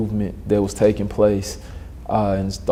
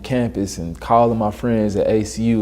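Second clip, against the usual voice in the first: normal talking voice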